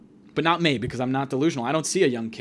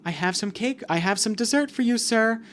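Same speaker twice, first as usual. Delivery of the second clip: lightly voiced polite AI, higher pitch; nice AI; 7 semitones higher than usual. Nice AI offers dessert to a patient, polite higher voice. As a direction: offer it sweetly as a robot helper. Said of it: "it's, like, a really nice A I".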